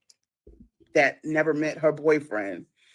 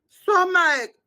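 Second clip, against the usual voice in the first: ordinary talk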